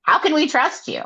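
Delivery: high, put-on Amren voice; mock Amren. Amren scolding Nesta in a high put-on voice. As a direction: scold her as Amren, hammed-up. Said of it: "Amren tells her".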